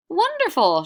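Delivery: very high, loud cheer; shouting. Acted. Travelers cheering the potato man, loud high cheer. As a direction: cry it out with delight. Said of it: "shouted the travelers".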